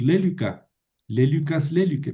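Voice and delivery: lélica, lélicas, lélique stressed on the opening LÉ; LÉ-li-ca, LÉ-li-cas, LÉ-li-que; antepenult stress. Shown as a demonstration. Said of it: "Entonces puedo decir".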